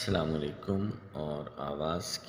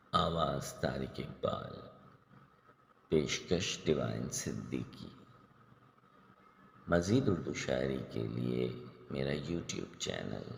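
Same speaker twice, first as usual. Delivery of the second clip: ordinary delivery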